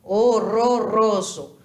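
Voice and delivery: Horroroso with every R trilled; trilled Spanish R. Shown as a demonstration. Teacher shows the Spanish R to students, trilled R. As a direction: roll each R in Horroroso. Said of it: "ele sempre vai vibrar em espanhol".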